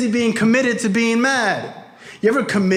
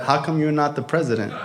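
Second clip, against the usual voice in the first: low, offhand question from a father; understated put-down; 4 semitones lower than usual. Retold or imitated. Father sees the vice president plaque, understated put-down. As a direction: deliver it low and deadpan. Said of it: "My father said".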